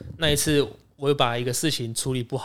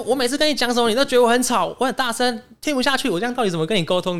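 mock shrill boss rant; 10 semitones higher than usual. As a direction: rant high like the nagging manager. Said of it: "他说".

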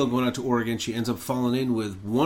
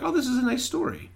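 high, sing-song mock delight; mock sweet viewer; 13 semitones higher than usual. Viewer fooled by the happy turn, high mock sweetness. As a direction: gush it in a high naive voice. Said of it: "So you're like".

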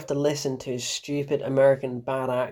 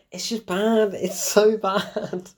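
higher-pitched, incredulous laughing complaint; exasperated laughing; 6 semitones higher, much slower than usual. Real, not acted. Reviewer despairing at the actor's stupid accents, laughing. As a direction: laugh in despair, drawing out the last words.

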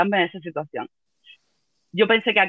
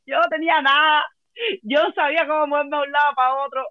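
teary voice; 5 semitones higher than usual. Real, not acted. Still laughing tearfully.

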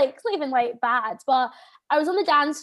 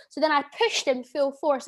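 usual speaking voice

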